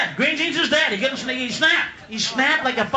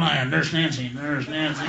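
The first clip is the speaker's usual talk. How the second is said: very low, lewd groaning Nurse Nancy line; seedy mutter in the back of a dark theater; Pee Wee moaning; 11 semitones lower, 4 dB quieter than usual. Retold or imitated. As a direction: drop the voice way down and leer. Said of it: "in a fucking adult theater going".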